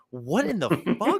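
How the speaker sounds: slow, drawn-out incredulous question; mock stunned reaction; disbelief. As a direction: stretch it out in sheer disbelief.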